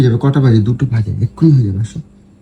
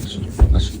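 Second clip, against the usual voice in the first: whisper; 5 dB quieter, faster than usual. Real, not acted. Coaxing partner, daughter sleeping nearby.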